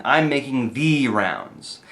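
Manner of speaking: slow phrase with "the" accented as "thee"; drawn-out "the" standing out over "rounds"; stress on THE. Shown as a demonstration. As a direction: lean unnaturally on "the", said "thee". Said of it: "it stresses a word that's a connecting word".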